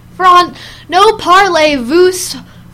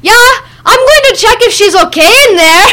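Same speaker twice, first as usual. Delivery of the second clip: lightly imitated Mexican accent, raised; a hint of a Mexican accent, higher and louder; 7 semitones higher, 6 dB louder than usual. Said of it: "this heavy Mexican accent go".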